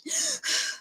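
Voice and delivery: high tearful sob; sob, breath catching; shaky sob. Real, not acted. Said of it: "I started crying".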